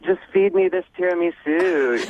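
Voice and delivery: high cooing flirt. Retold or imitated.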